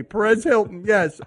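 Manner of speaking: ordinary talk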